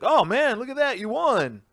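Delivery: mock astonishment, way up and loud; carny pretending to be amazed at the win; fake amazement. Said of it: "He's like".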